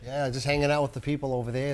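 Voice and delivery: low, casual Boston accent. Shown as a demonstration. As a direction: put on a Bostonian's dropped Rs. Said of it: "doing a Boston accent".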